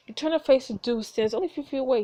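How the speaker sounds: ordinary delivery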